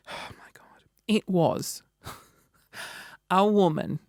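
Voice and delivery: breathless whispered "Oh my God"; shocked whisper. Pretend. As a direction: hush it out in disbelief. Shocked reveal of stripper.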